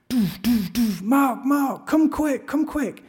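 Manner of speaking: high, muffled calls through the van walls, the last words drawn out slowly; wife locked in the van calls her husband to come quick; muffled cries for help. Acted. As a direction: thump on the door, then cry out for Mark from inside. Wife trapped, banging and calling.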